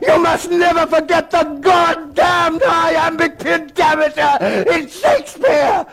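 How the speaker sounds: high, ranting headmaster tirade; frenzied, hectoring scream; enraged screaming. Acted. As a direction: rage at the pupil, climbing to a shriek. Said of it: "he began to scream".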